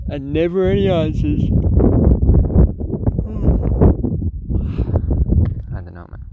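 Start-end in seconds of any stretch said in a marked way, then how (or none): 0.1-1.4 dramatic high lament. Real, not acted. Dramatic musing aloud. 1.5-5.7 long dramatic sigh, high and loud. Real, not acted. After unanswered questions.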